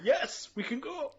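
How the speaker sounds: excited yes. Retold or imitated. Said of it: "she's like".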